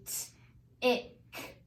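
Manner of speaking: "t", pause, "ick" with short I; sounded out. Shown as a demonstration. Teacher sounds out the last syllable, sound by sound. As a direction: separate "t" from "ick". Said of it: "this is gonna be a short I".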